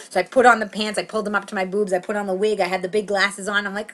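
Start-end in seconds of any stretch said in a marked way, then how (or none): none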